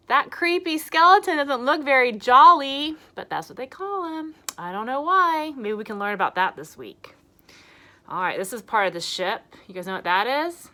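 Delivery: ordinary talk, nothing marked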